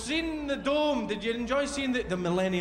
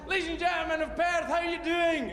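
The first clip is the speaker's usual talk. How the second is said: showman greeting; 7 semitones higher than usual. Retold or imitated. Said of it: "he bound onto the stage and he said".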